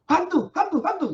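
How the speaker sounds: hammed-up ghost panic; frightened shouting. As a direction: shriek it as if terrified. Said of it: "berteriak-teriak karena takut".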